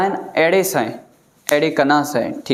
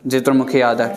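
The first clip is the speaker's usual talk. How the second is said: ordinary delivery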